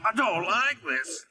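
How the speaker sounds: high, strained complaint; groaning. A narrator's reading. Scruffy being stretched by Oliver's heave, high groaning. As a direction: moan it in a high voice. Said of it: "groaned Scruffy".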